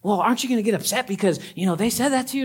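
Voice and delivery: high, fast needling friend; mock instigator. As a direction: push them to get upset.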